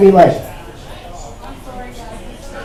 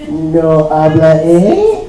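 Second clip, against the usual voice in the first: Canadian giveaway; 3 semitones lower, much slower than usual. Acted. Worker caught out as Canadian by his boss, slow then squeaking up. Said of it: "Wait, aren't you Canadian?".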